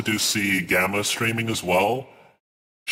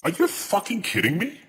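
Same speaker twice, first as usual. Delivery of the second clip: disbelieving outburst; 9 semitones higher than usual. Real, not acted. Streamer outraged at game announcement.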